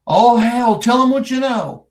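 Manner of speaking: loud, high-pitched blurt; retold father relenting. Father telling his son to talk to the investigator, retold loudly. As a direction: throw it out loudly like an exasperated dad. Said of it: "his daddy comes out and says".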